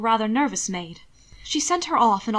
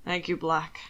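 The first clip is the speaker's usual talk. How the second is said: a hint of a deeper gentleman; low, lightly voiced Hannibal; 5 semitones lower, 4 dB quieter than usual. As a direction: say it low and composed. Hannibal thanks the butler.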